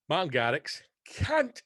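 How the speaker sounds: ordinary talk, nothing marked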